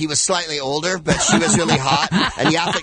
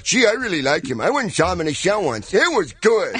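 mock-naive, higher-pitched fan; bland storyteller; 4 semitones higher than usual. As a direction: imitate a simple fan telling a dull story. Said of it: "I don't tell a [name] story like".

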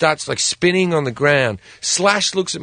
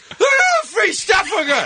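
put-on very high girlfriend squeal; mock excited squeal. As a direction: squeal like a hammed-up freebie grabber. Girlfriend boasting about freebies, acted.

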